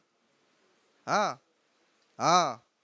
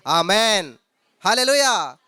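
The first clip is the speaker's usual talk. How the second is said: loud amen call; 8 dB louder, much slower than usual. Real, not acted. Preacher calls out to congregation.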